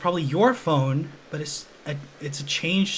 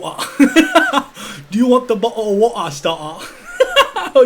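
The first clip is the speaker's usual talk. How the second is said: high, loud laugh through the words; 6 semitones higher, 8 dB louder than usual. Real, not acted. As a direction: crack up at your own silly accent. Cracking up at own joke pronunciation.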